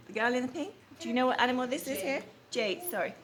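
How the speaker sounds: plain reading voice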